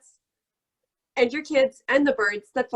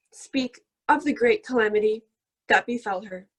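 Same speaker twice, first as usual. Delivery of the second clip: grand epic poem reading; mock epic recitation. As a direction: recite like Homer, solemn and grand. Mock epic poem about dog.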